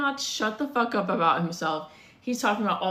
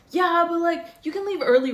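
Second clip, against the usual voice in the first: high, loud wheedling imitation; mock pleading; 7 semitones higher, 4 dB louder than usual. Date urging woman to leave work early, mock pleading. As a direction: push back like an insistent date.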